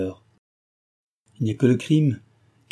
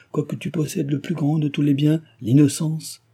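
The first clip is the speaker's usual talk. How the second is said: higher, insistent reproach; exclaiming; 7 semitones higher, 4 dB louder than usual. A narrator's reading. He reminds Cécile she still has innocence, exclaiming. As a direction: press the rebuke in a raised voice. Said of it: "s'écria-t-il".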